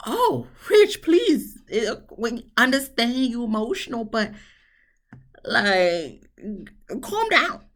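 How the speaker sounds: high, whiny please to calm down; high exasperated whine. Retold or imitated. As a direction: beg him to pull it together. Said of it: "And I'm just like".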